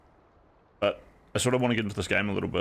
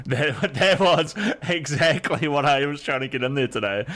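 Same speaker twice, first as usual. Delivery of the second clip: excited, higher, laughing through words; 5 semitones higher, 4 dB louder than usual. Real, not acted. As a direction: giggle while you say it. Excited laughing about clip.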